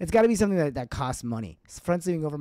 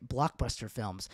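ordinary talk, nothing marked

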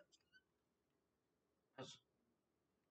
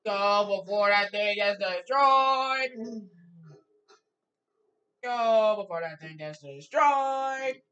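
slow, loud drawn-out urging; slow dramatic; 13 dB louder, much slower than usual. Pretend. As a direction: drag each word out loudly.

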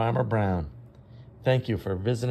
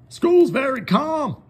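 much higher, louder, slow and firm; stern Farmer Brown; 10 dB louder, slower than usual. Acted. Farmer Brown insists school is calm, firm and slow. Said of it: "said Farmer Brown".

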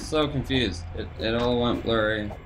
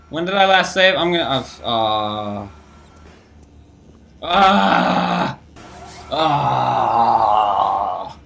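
panicking gamer; 6 dB louder, much slower than usual. Real, not acted. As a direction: panic over the lost save. Low health, panicking.

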